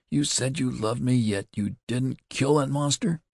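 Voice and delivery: high, wild accusing cry. A narrator's reading. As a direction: burst out at him, pitch rising high. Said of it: "she cried wildly".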